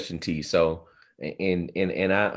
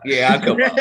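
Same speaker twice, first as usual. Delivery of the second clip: mock excited; 6 semitones higher, 7 dB louder than usual. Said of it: "You be like".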